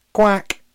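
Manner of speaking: high, loud mock duck quack; cheeky quack. A narrator's reading. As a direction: quack it out to tease. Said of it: "said Alex bravely".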